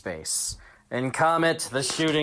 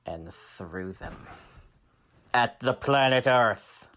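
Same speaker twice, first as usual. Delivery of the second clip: usual speaking voice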